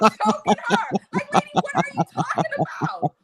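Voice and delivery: high-pitched words through laughter; incredulous, laughing high voice; laughing disbelief. Real, not acted. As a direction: crack up while protesting. Laughing while imagining confronting the lady.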